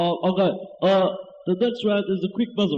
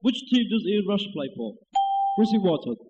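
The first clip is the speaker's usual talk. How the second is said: usual speaking voice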